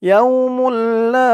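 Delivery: ইয়াউমুল লা with লা stretched long and high; long drawn la. Shown as a demonstration. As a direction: draw out the লা one alif length. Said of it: "লা এক আলিফ টান".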